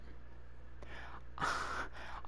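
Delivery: breathy exasperated sigh; short weary sigh. Real, not acted. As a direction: sigh out in defeat.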